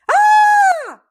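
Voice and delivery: piercing high cry. A narrator's reading. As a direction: scream out from below. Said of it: "The cry was followed".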